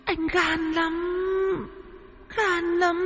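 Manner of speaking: high, soft, slow wail of a ghost; haunting dead lover calling to her man; ghost woman's muddied voice. A narrator's reading. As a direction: draw out each word eerily, high and hushed. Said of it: "âm thanh chồng đục".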